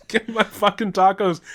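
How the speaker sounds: half-laughing exasperated demand; frustrated outburst. Retold or imitated.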